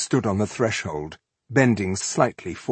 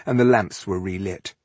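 usual speaking voice

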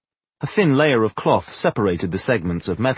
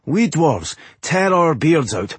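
higher, put-on dwarf voice; indignant dwarf; 5 semitones higher than usual. A narrator's reading. Dwarf complains about patrolling the ranges, indignant.

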